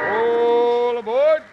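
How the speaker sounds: loud, high, slow all aboard call; conductor's call. Acted. Host calls out train departure.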